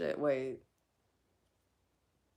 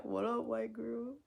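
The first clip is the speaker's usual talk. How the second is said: slow, drawn-out tough-guy greeting; slow drawn-out mock greeting; 4 semitones higher, 5 dB quieter than usual. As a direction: stretch it like a cool guy. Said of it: "He said that was the plan".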